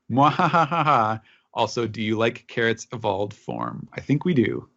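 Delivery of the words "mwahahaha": slow evil cackle; mad scientist laugh. Acted. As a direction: cackle like a villain. Reading listener's gloating letter.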